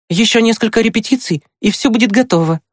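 put-on high, brisk boyish boast; boastful. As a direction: show off in a high, lively voice. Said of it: "хвастливо произнёс он". (хвастливо, высоким мальчишеским голосом)

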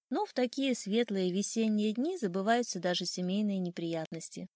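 ordinary delivery

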